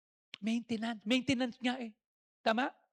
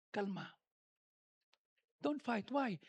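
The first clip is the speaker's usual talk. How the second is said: calm hush; 6 dB quieter than usual. Acted. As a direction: settle them down. Calming contrast.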